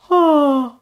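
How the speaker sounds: high yawning intake, open throat; demonstrated yawn. Shown as a demonstration. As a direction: fake a yawn to show the tension. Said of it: "Can you do it again?".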